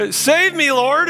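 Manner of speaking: high, loud cry for help, acted out; mock yell. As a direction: call out like you're sinking. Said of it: "he yells out to Jesus".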